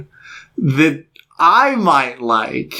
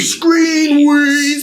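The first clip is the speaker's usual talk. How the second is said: hyped show name; 11 semitones higher than usual. Acted. Shouted show name sign-off.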